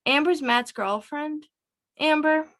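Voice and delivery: mild acted surprise; dawning disbelief. A narrator's reading. Anaya figures out who it is.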